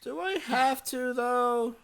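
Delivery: high, drawn-out reluctant whine. Real, not acted. Streamer protesting muting the opening, reluctant whine. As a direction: whine it slowly, pitched well up.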